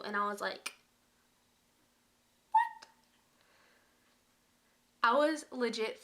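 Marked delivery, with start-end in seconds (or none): 2.5-2.9 a very high startled what; high squeaky what; shocked squeak. Retold or imitated. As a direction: shriek it in disbelief. Shocked reply.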